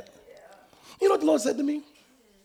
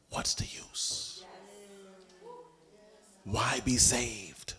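very soft, very slow whispered temptation; tempting enemy's slow hushed voice; sly tempter whisper; 12 dB quieter, much slower than usual. Retold or imitated. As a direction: hiss it like a seductive devil.

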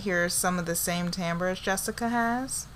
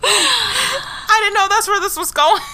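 high, loud, cracking up; laughing; 12 semitones higher, 7 dB louder than usual. Real, not acted. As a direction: say it through helpless laughter. Cracking up at sister confession.

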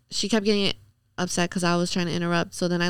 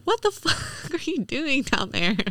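laughing disbelief; 8 semitones higher than usual. Retold or imitated. Retold quote, laughing mid-line.

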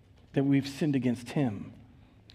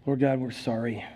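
prayer. Acted. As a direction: lead the congregation in confession. Leads a prayer of confession.

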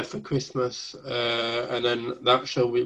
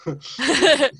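surprised; 12 semitones higher, 5 dB louder than usual. Real, not acted. Baffled at own words.

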